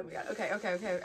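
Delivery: usual speaking voice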